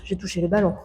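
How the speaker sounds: ordinary talk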